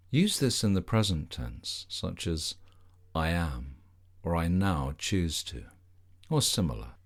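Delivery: soothing Yoga Nidra guidance, unhurried and even; guided meditation. Acted. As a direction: lead the listener gently into rest, one body part at a time.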